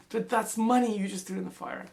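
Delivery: high, shocked protest; shocked cry. A narrator's reading. Son protesting to his father, high and shocked. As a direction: gasp it out in disbelief.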